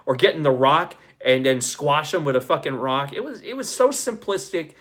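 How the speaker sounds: usual speaking voice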